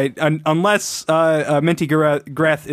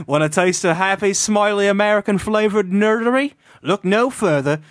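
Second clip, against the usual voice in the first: put-on Welsh accent; 3 semitones higher than usual. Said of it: "I can't read far enough ahead with this voice".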